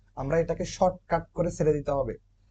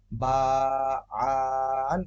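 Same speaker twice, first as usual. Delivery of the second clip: drawn out wrongly; slower than usual. Shown as a demonstration. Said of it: "এরকম বলা যাবে না".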